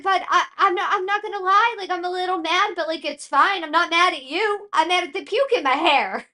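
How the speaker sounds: lightly voiced, high calm mom; patient mom. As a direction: talk him down kindly but honestly. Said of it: "I'm like".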